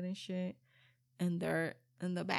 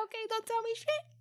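high, fast mock-breezy okay; mock resigned; 14 semitones higher, faster than usual. Mom mimicking her shrug at kids' secrets, high and fast. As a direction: toss it off high and quick, pretending not to care.

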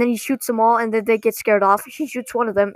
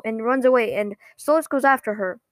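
ordinary delivery, nothing marked

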